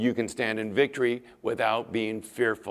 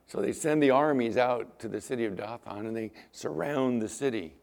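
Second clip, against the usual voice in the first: ordinary talk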